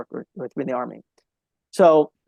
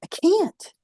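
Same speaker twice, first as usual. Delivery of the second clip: upset colonel; 5 semitones higher than usual. Retold or imitated. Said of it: "how upset he was".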